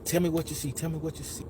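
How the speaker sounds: soft, fading repeated coaxing; nice lady's voice. Retold or imitated. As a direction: imitate a kind woman softly prompting. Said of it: "that lady nice voice".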